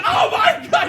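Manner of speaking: high cry of alarm, acted out; hammed-up high-pitched shock; startled mock yell. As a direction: yelp as if jolted awake. Father jolted awake in pain.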